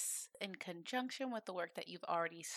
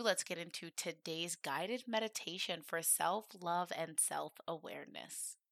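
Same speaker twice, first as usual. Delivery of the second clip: ordinary talk, nothing marked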